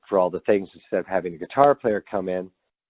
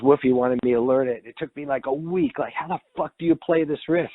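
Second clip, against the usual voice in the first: higher-pitched, exasperated quote; exasperated retelling; 7 semitones higher than usual. Retold or imitated. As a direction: retell a baffled friend's complaint in a higher voice. Acting out Eddie's exasperated question.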